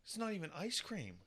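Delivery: exasperated. Real, not acted. Father exasperated by daughter.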